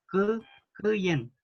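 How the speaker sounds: "cuyen" with the ü nearly gone, k straight into y; no vowel in cuyen. Shown as a demonstration. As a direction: split off "Cu-", then glide "cuyen". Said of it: "como si no hubiera ninguna, eh, vocal entre la K y la Y".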